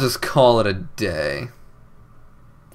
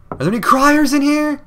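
much higher and louder, sing-song call; high calling shout; 14 semitones higher, 6 dB louder than usual. Acted. Searching for the crier, calling out high and loud. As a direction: holler the question to the whole building.

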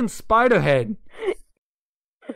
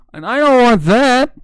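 high loud nervous cackle; loud panicked laugh; 8 semitones higher, 8 dB louder than usual. Real, not acted. Panicked about dying in game.

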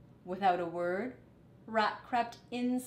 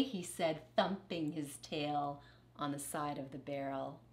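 ordinary delivery, nothing marked

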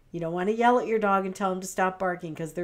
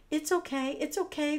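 high, fussy comforting voice; over-soothe like a fretting friend; coddling; 8 semitones higher than usual. Shown as a demonstration. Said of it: "coddle our dogs or tell them it's gonna be okay".

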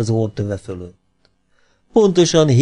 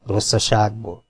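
ordinary delivery

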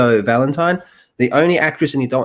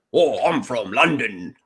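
slow, drawn-out mock London accent; hammed-up London English; slower than usual. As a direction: tease with an exaggerated London accent. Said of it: "do a Harley Quinn voice".

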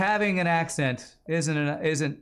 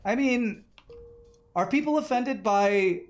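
high mock-incredulous voice; 9 semitones higher than usual. Questioning the offense in high voice.

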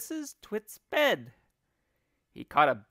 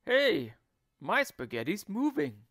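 raised, startled exclamation; cried out; 6 semitones higher, 4 dB louder than usual. A narrator's reading. Husband finds his spaghetti moving, startled cry. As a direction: call out in surprise. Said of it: "cried Mister Twit".

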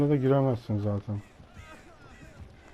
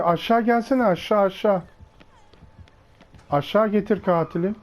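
calling out; 6 semitones higher than usual. Real, not acted. Calling teammate to bring killer.

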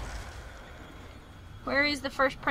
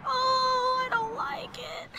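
squeaky scared voice; 11 semitones higher, 4 dB quieter, much slower than usual. Acted. Keeps the squeaky voice, quieter.

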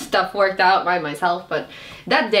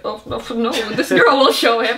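mock confident; 5 semitones higher, 5 dB louder than usual. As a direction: boast it knowing you can't. Quoting self, ironic boast.

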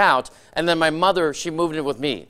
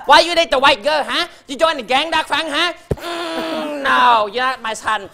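high, loud scolding mother voice, slower at the end; 10 semitones higher, 5 dB louder, slower than usual. Retold or imitated. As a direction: disown him like a furious mom. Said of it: "while she's yelling at me".

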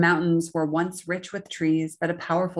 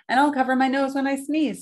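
high, raised storybook dragon voice; 8 semitones higher, 5 dB louder than usual. A narrator's reading. Dragon making peace with the king, high voiced. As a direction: promise brightly as the dragon. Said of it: "said the dragon".

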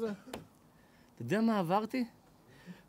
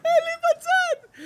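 mock protest; 4 dB louder, much slower than usual. Mock reply in comic retelling.

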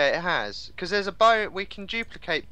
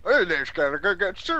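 farmer voice; 3 semitones higher, 3 dB louder than usual. Pretend. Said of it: "farmer's voice".

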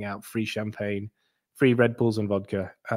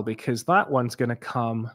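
ordinary talk, nothing marked

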